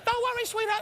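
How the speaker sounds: very high, light bird-like voice. Retold or imitated. Rickles imitates his old manager, very high bird voice. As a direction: squeak it up high like a tiny bird. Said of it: "he had a voice like a bird".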